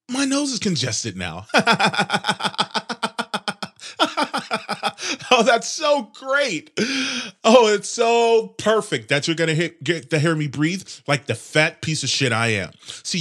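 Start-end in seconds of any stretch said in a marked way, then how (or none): 1.5-5.2 a high, bitter laugh at his own bad luck; sarcastic laugh. Real, not acted. Annoyed host laughs at his bad recording luck, high and sour. 5.3-11.8 sarcastic. Real, not acted. Host mocks his stuffed nose, high sarcastic delight. Sarcasm about the noise and congestion.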